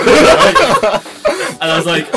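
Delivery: laughing. Real, not acted. Laughs at own story.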